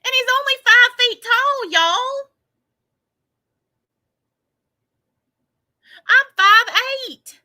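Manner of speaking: high, loud comic dismay; mock outraged wail. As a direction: cry out in hammed-up despair. Mock distress over his height.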